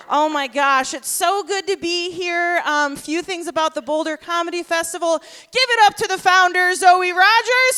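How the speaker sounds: ordinary talk, nothing marked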